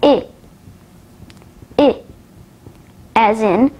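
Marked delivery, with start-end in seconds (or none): 0.0-2.0 short, isolated "I" as in fish; short vowel demo. Shown as a demonstration. Teacher showing learners the short vowels, "I" said short. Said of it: "Take a look at the short vowels".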